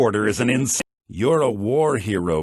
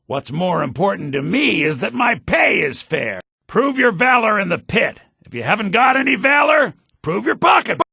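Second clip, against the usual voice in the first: loud, higher barker's pitch; loud hawker; 7 semitones higher, 4 dB louder than usual. Acted. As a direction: sell the fight pit to passersby. Pit master hawking fights.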